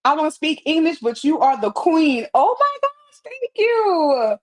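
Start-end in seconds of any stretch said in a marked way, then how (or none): 2.3-4.4 delighted surprise. Real, not acted. Viewer calls her the queen.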